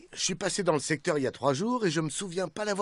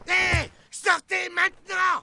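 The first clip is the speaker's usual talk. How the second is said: high, drawn-out shouted order; yelling; 14 semitones higher, 6 dB louder, slower than usual. Acted. Knight orders whoever is inside to come out, yelling. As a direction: bellow it slowly at the whole building. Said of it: "Pas la peine de hurler".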